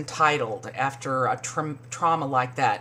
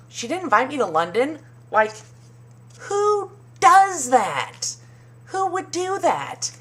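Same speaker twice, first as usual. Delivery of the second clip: much higher, hammed-up indignant voice; mock Ramona; 10 semitones higher, 4 dB louder than usual. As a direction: drag out "who does that" in fake shock. Voicing Ramona's outrage.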